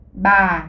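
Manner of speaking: ba dropping low and falling. Shown as a demonstration. Teacher models ba with the low falling tone. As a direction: let ba sink down low. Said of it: "accent grave".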